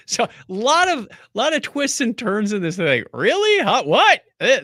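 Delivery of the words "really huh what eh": surprised. Real, not acted. Reacting to twist.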